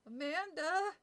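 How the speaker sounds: little brother calling for his sister, scared. Acted. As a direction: call her name like you're scared for her. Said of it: "Josh sounded very scared".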